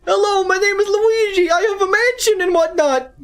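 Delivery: very high, cartoonish Luigi voice; squeaky little Luigi introducing himself; Luigi impression. Streamer demos his Luigi voice, very high and silly. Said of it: "this is the voice for Luigi".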